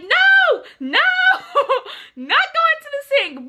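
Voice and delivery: high shouted no, breaking into laughter; laughing refusal. Retold or imitated. Refusing the teacher's sink, high laughing shout. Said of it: "And I'm like".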